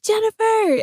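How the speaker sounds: high, drawn-out call of a name. Retold or imitated. Mom calling her daughter in from the bushes. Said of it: "she's like".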